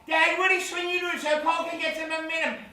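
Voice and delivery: put-on high, excited kid voice; cheeky young boy. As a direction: play a boy egging on a car game. Plays boy in back seat.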